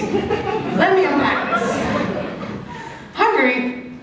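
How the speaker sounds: usual speaking voice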